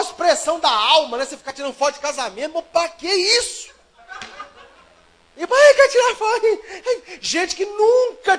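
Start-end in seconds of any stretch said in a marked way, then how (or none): 2.5-8.4 higher, slow, laughing protest; laughing mock outrage. Real, not acted. Groom complaining to the church about photos, laughing scoff.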